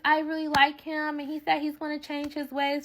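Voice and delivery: high-pitched, mock-earnest excuse; imitated lovestruck friend. Friend defending the man she likes, mock-earnest pleading.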